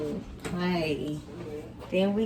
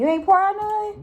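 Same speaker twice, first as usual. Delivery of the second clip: surprised; 13 semitones higher, 4 dB louder than usual. Real, not acted. Friend downed the whole shot.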